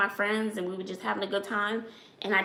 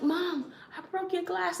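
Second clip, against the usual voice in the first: high, softer, fearful little confession; scared child confessing; 10 semitones higher, 5 dB quieter than usual. Retold or imitated. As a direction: admit it like a kid afraid of punishment. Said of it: "I was so afraid to tell her".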